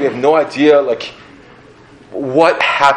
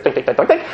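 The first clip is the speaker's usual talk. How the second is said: clumsy climbing sound; 3 semitones higher, faster than usual. Retold or imitated. Short legs climbing down tree.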